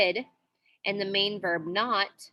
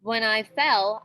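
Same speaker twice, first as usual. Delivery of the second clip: usual speaking voice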